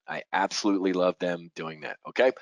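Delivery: normal talking voice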